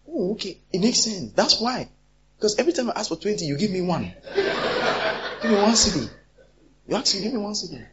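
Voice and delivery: high, sarcastic jeering; mock the stingy friend; mocking retort. Preacher acting a friend mocking the one-cedi gift, sarcastic. Acted quarrel over cedis.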